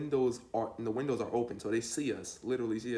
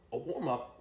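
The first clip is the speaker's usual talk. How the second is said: higher, disbelieving question; incredulous echo; 5 semitones higher than usual. Retold or imitated. Smoker questioning his friend's words, incredulous. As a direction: throw the words back in disbelief.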